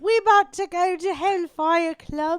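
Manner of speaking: slow mock British voice; Missus Doubtfire. Co-host teasing friend about the club, mock British nanny. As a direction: draw it out in hammed-up British. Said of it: "It's me, Missus Doubtfire".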